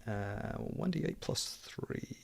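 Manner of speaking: muttered under breath; whispered. Real, not acted. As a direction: whisper the dice roll to yourself. Muttering dice math.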